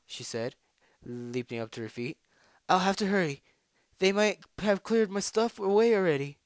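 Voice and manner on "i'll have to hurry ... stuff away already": high, loud and hurried-sounding fret; raised, anxious urgency; urgent worry. Acted. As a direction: fret out loud about being late. Hurrying, fears losing her things.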